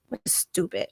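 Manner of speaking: dismissive whisper; whispered aside. Real, not acted. A whispered, dismissive aside about the label. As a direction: whisper it under the breath.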